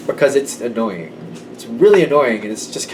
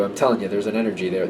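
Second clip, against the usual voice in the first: normal talking voice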